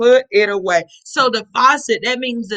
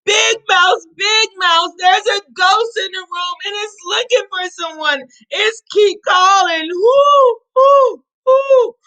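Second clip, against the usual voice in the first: higher, louder scared calling; frightened yell; 7 semitones higher, 4 dB louder than usual. Acted. Little mouse yells to big mouse about a ghost, frightened. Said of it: "And he yelled".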